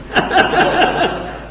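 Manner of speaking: rapid bursts of laughter. Real, not acted. Teacher amused by his pupils, laughing. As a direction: laugh in a quick run. Amused while questioning the listeners.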